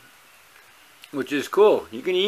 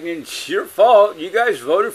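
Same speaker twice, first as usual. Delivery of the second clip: mock accusation; 6 semitones higher, 5 dB louder, slower than usual. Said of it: "y'all like".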